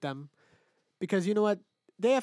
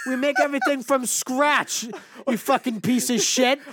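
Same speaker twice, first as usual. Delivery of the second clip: loud, high-pitched exasperated outburst; fed-up, heated outburst; angry rant; 6 semitones higher, 6 dB louder than usual. Real, not acted. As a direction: vent at rude customers, voice climbing. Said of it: "I'm getting fucking angry".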